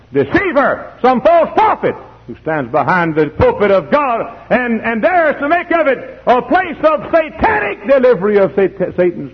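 high, loud denunciation; preacher's high-pitched attack; full-force preaching. Real, not acted. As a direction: denounce it from the pulpit, climbing.

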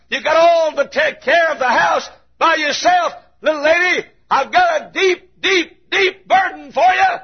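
normal talking voice

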